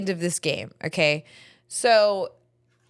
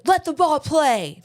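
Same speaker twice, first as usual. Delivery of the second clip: loud, higher, drawn-out coach's shout; imitated yell; 6 semitones higher, 5 dB louder than usual. Coach yelling at a parent who picked up ball, imitated shout. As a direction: call it out slowly, like a coach yelling. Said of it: "he one time yelled at Matthew".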